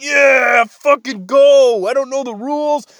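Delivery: high, loud cheering rant; excited sports fan. Acted. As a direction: cheer like a clueless converted fan. Acts out himself cheering at curling.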